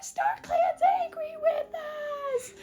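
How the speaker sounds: very high, drawn-out mock wail; hammed-up crowd panic; panicked freak-out. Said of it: "everyone starts freaking out".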